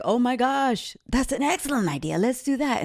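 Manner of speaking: high, quick gushing praise; high-pitched boss gushing; mock excited owners. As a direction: gush over the idea like an impressed boss. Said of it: "the owners would be like".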